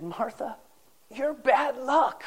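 high, slow, drawn-out dying man's verdict; a hint of a cranky dying man delivering the joke; deadpan punchline. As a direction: let the last words land slowly like a grumpy old husband. Dying husband's punchline to his wife.

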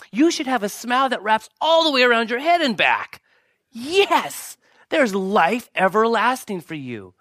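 high, loud, exultant proclamation; rally the congregation with joyful high-pitched shouts; jubilant preaching shout. Real, not acted. Rallying congregation about going to heaven.

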